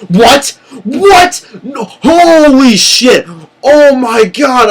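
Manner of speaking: high, loud, stretched out "What? What?"; shocked disbelief. Retold or imitated. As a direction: gasp it out as if your hero just won. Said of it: "I'm like".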